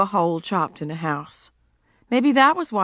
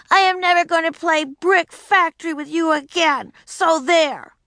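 put-on spiteful child; 9 semitones higher, 3 dB louder than usual. Punishing Howie.

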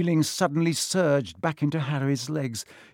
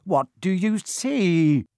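slow, louder, higher demand; impatient; 6 semitones higher, 5 dB louder, slower than usual. A narrator's reading. Quirrell demands what Harry sees in the mirror, impatient. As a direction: press the question, rising with impatience. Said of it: "said Quirrell impatiently".

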